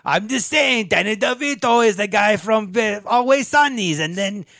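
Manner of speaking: high, loud, over-the-top Canadian French; hammed-up French Canadian impression; Canadian French accent. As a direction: imitate an exaggerated Quebecois. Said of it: "his Canadian French accent".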